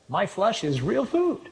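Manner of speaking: high, drawn-out absurd punchline; mock announcement. Absurd line in imagined press conference.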